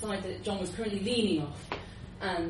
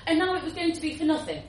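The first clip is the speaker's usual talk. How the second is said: ordinary delivery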